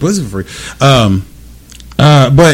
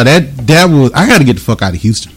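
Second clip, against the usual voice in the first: ordinary delivery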